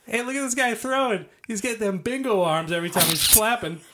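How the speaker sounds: high mocking carny heckle; hammed-up jester taunt; heckling jester. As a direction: roast him like a jester on a stage. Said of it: "he goes".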